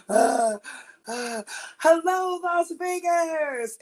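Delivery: high, slow, breathless hello to the crowd; breathless stage greeting. Retold or imitated. Singer greets the Las Vegas crowd, out of breath and slow. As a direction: stretch it out as if gasping for air.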